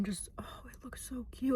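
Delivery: unvoiced, soft gush; whisper. Real, not acted. Admiring her lip color, whispering. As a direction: whisper it close to the mic.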